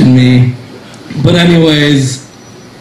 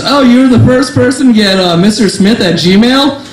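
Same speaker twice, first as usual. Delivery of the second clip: higher, wide-eyed impressed voice; woman impressed by a fake email, higher; 7 semitones higher than usual. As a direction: marvel at it naively.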